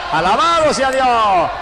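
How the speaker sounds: high, loud, slow cry of praise; shouted praise. Real, not acted. Preacher to the congregation, a slow shouted praise. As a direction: hold it out like a battle cry.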